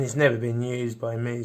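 ordinary delivery

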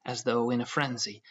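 ordinary delivery, nothing marked

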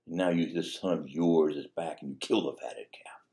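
hissed, unvoiced bitter complaint; bitter whisper. Resentful son to his father, whispered and bitter. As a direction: spit the words under your breath. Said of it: "the way he's speaking to his father".

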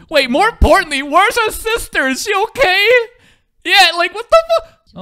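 high, loud, urgent questioning; alarmed shouting. Real, not acted. Viewer worried for a character's sister, alarmed shouting.

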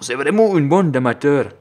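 loud, high mock Russian accent. Gamer redoing his insult to the gunners, Russian accent. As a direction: declare it with a comic Russian accent. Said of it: "Je prends l'accent russe maintenant".

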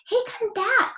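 crying out. Acted. Moonface calling after the duck, high alarmed call. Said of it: "cried Moonface".

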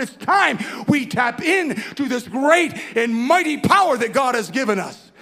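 loud, slow, sung-out preaching line. Acted. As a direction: lift it into a preacher's chant. Preaching at full force.

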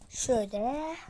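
normal talking voice

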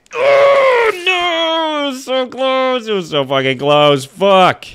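high loud cry of despair; anguished shout. Real, not acted. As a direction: wail at the near miss. Just missed the jump.

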